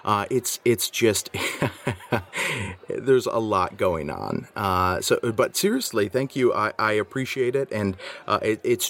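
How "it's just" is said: laughing. Real, not acted. Laughing mid-sentence.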